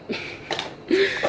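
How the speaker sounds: high breathy burst of laughter; sudden giggle. Real, not acted. Laughs at the British accent remark.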